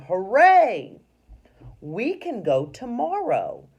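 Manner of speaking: loud, high cheer; shouting. Shown as a demonstration. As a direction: shout it out with joy. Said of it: "So it's telling you that she's shouting".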